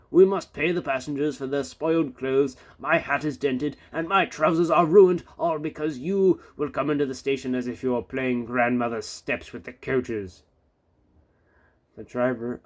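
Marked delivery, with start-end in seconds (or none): 0.1-10.4 scolding controller. A narrator's reading.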